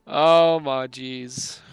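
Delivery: loud, slow, drawn-out amazement; excited disbelief. Real, not acted. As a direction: stretch it out in delighted shock. Reacting to great draw.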